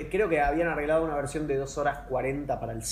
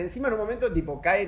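ordinary delivery

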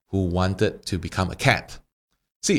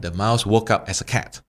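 normal talking voice

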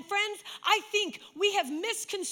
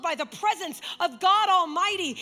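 preaching at full force; 4 semitones higher than usual. Acted. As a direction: build to a fervent climax. Preaching climax over music.

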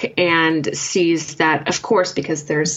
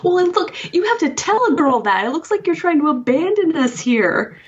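put-on much higher, scolding young woman; much higher, indignant character voice; indignant Lori; 12 semitones higher than usual. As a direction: play Lori calling him out. Lori argues with Davis.

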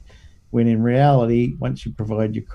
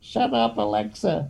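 put-on old lady Irma; 10 semitones higher, 6 dB quieter than usual. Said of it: "pretends to be a little old lady called Irma".

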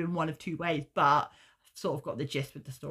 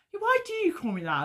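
put-on much higher, questioning kid voice; high-pitched puzzled mimicry; Jasmine; 14 semitones higher than usual. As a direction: imitate a daughter querying her nickname. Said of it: "And Jasmine's like".

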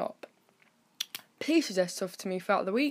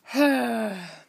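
long weary sigh; heavy exhale of someone worn out; tired sigh; 3 semitones higher, 6 dB louder than usual. Real, not acted. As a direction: let out a drawn-out, tired breath. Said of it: "I'm so tired".

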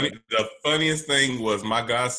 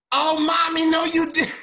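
put-on much higher, loud laughing kid's cry; a kid wailing in laughing disbelief; child shriek; 4 dB louder than usual. Kids realizing the April Fools prank, in a high laughing shriek.

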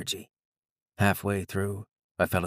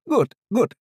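put-on high-pitched Indian-accented old doctor; thick Indian accent; 14 semitones higher, 6 dB louder than usual. A narrator's reading. Doctor testing eye tracking, high and loud in a thick Indian accent. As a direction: raise the voice, strong Indian accent. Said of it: "he said again".

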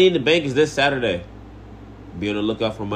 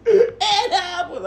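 high, slow laughing repeat; 4 dB louder, much slower than usual. Acted. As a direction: say it through laughter.

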